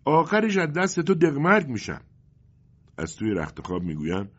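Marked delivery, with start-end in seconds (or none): 0.0-2.0 much higher and louder shout; shouting mother. Acted. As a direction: yell it out in exasperation. (فریاد مادر کلافه، بلند و زیر)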